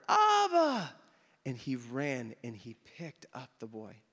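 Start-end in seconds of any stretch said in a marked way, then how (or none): 0.1-0.9 high, loud imitated child's wail; child crying out. Hebrew boy crying for his father, high drawn-out yell. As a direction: call out for father like a small child. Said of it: "the noise got louder".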